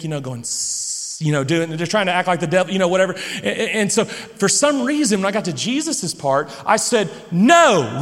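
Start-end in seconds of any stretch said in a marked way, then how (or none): 0.4-1.2 drawn-out mock snake hiss. Dad playing the snake for his boys, hissing. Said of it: "acting like a snake". 7.3-8.0 loud, booming mock refusal; real loud. Dad reading Jesus's part to his kids, real loud. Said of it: "real loud".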